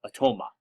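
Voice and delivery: "atoma" as uh-TOE-muh, no final s; pronunciation variant "atoma". Shown as a demonstration. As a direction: stress the long "toe" in "atoma", ending on "muh". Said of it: "I've heard it pronounced".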